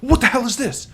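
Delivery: high-pitched acted outrage; mock angry demand, very high; imitated yelling mom. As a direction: confront the kid as a furious parent, retold. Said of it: "yells at him".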